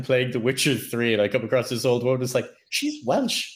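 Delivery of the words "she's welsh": very high-pitched mock astonishment; surprised recognition. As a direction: pipe up as if suddenly recognising someone. Retells delighted recognition of accent.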